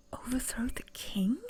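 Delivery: repeated in a stunned whisper; hushed disbelief. Acted. Princess whispering back the stranger's plan in stunned disbelief. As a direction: drop to a whisper as the plan sinks in.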